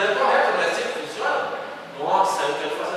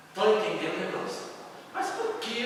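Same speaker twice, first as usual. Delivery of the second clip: usual speaking voice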